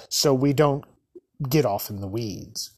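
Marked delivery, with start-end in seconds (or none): none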